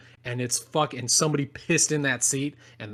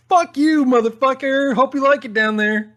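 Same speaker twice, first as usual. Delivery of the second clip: high, loud yell at the hearse; mock yelling; 12 semitones higher, 9 dB louder than usual. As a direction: scream it at the coffin, hammed-up. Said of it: "getting in front of the hearse and going".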